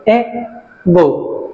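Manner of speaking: এগো broken in two, এ then গো; short gap between the syllables of এগো; syllable split. Shown as a demonstration. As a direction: say এগো slowly as two separate beats. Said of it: "দুটো syllable".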